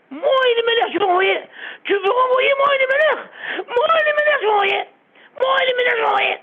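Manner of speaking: high, loud anguished shouts; crying out. Acted. Rabbi cries out over sending away his son, anguished shout. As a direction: shout in grief, voice breaking. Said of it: "le rabbi a commencé à crier comme ça".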